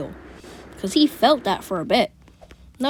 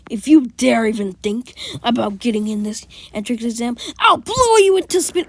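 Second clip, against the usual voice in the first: furious threat; 3 semitones higher, 7 dB louder than usual. Retold or imitated. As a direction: blow up at Deku by the end. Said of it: "If I go like".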